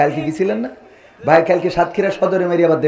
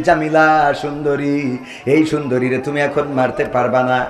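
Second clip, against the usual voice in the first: melodic sermon storytelling; 4 semitones higher, 6 dB louder than usual. A narrator's reading. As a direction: tell the tragic tale in a singsong preacher's cadence. Preacher chants a tragic tale.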